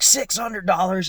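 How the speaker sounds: high, loud mock outrage at the price; a client balking at the locksmith's price, hammed-up outrage; outraged disbelief. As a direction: squeal the price back in shock.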